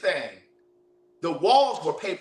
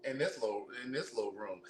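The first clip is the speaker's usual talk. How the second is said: dismayed murmur; 5 semitones lower, 14 dB quieter than usual. Retold or imitated. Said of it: "I was like".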